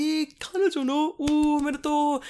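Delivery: very high mock kid voice; teasing kids. Kids congratulating teacher on girlfriend, imitated falsetto Japanese. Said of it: "And they're like".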